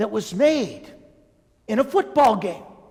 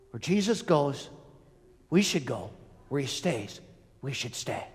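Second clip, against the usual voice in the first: hushed conclusion; 8 semitones lower, 9 dB quieter than usual. Real, not acted. Preacher's conclusion.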